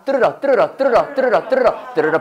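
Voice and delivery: "뚫으러" rattled off as a sound-alike for "through the"; repeated "뚫으러", syllables run together like English; pronunciation drill. Shown as a demonstration. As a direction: chant "뚫으러" in quick, even repeats. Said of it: "더 발음 안 나?".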